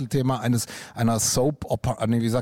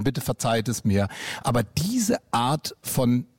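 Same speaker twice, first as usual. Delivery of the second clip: usual speaking voice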